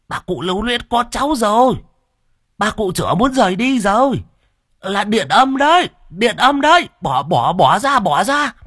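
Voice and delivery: put-on high, loud frantic old man warning; panicked village shaman voice, pitched high; alarmed old shaman. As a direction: shriek out the danger like a startled shaman. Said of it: "thì thất kinh".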